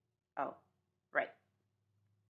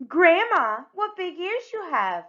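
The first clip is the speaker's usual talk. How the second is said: higher and louder, really playing the part; trying the line again with more gusto; livelier delivery; 9 semitones higher, 7 dB louder than usual. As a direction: sing out the line like a wide-eyed girl. Repeats line with more feeling.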